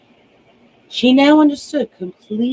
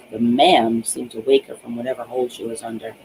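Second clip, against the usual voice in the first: ordinary delivery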